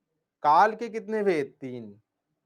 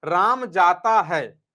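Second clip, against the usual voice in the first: slow, louder example sentence; demo example; 5 dB louder, much slower than usual. Shown as a demonstration. Teacher showing the example sentence, slow and emphatic. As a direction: spell out राम जाता है slowly and clearly. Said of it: "देखो".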